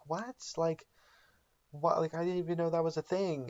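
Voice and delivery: high, incredulous imitation; can't believe what she hears; baffled disbelief. Skylar baffled by necromancy.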